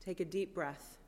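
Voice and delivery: calm them into stillness, hushed; guided meditation. Acted.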